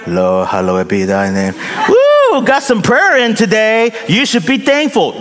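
laughing, then a high woo of self-congratulation; mocking a quick prayer on a bathroom break; mock casual prayer. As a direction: toss off a lazy prayer, then cheer. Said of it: "bathroom break".

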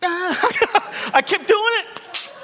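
high-pitched hammed-up wail; mock crying. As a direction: bawl comically like a baffled child, voice way up. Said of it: "And I began to cry".

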